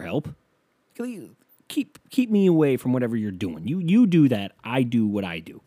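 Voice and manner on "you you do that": normal talking voice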